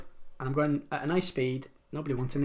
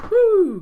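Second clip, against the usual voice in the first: high, loud "woo!"; celebratory whoop; 13 dB louder than usual. Real, not acted. Quizmaster cheering for the quiz prize, celebratory whoop. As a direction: let out a big high-pitched whoop. Cheering last week's winner.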